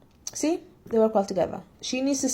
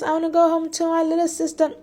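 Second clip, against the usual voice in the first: mock whiny heroine; 9 semitones higher than usual. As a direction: send up her homesick complaining in a high voice. Mimicking the heroine's complaints.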